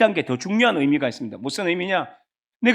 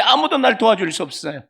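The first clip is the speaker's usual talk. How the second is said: usual speaking voice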